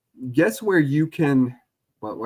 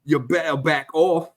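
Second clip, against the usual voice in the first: slow Cockney threat; mock Cockney; 3 semitones higher than usual. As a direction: warn him off in Cockney. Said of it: "you guys are very Cockney".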